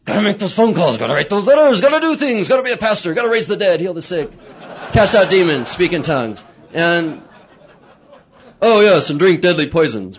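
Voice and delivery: mock-frantic pastor waking up; frantic to-do list. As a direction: rattle off the chores in a panic.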